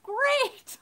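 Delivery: high-pitched grateful "great"; relieved thanks. Retold or imitated. Mom accepting the preschool teacher's help, high relieved reply. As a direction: sound saved.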